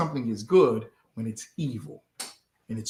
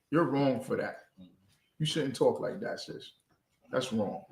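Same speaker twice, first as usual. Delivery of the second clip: softer, lower imagined reproof; quiet mock rebuke; 3 semitones lower, 8 dB quieter than usual. Acting out what nobody will tell her, a quiet reproof. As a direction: gently voice a correction, dropping softer.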